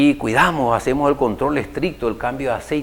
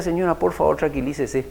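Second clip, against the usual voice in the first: softly imitated calming bystander; soothing plea; 7 dB quieter than usual. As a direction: quietly urge her to settle down. Bystanders soothing an upset woman. (súplica suave para calmar)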